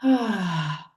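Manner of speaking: falling, breath-out "ah"; audible sigh. Shown as a demonstration. Guide closing the breathing exercise with listeners, audible sigh. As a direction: release the breath in an open sigh. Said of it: "let it out with a sigh".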